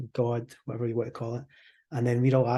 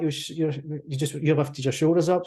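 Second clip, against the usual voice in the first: ordinary talk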